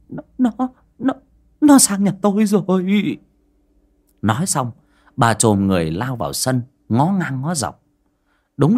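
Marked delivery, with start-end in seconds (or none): 0.1-3.2 high-pitched stutter that bursts out louder; panicked, stumbling shriek; terrified stammer. A narrator's reading. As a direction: choke on the first word, then cry out in horror. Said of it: "ú ớ".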